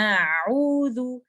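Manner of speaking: "आऊज़ु" drawn out slowly with a light hamza; Quranic recitation. Shown as a demonstration. Teacher reciting the example word, slow. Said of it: "इस case में आइन कहाँ से आ रहा था".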